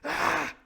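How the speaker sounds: high, loud-ish yell; short mock throwing cry; attacking cry. Shown as a demonstration. Pirate hurling his crutch, mock cry. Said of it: "He's like".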